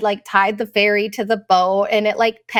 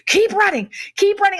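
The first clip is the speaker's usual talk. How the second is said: high, pressing keep-going call; husband urging the family to run; urgent urging; 11 semitones higher than usual. Retold or imitated. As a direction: hurry them along, mock alarm. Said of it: "Drew's like".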